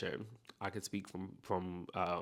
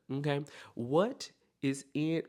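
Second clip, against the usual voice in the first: ordinary talk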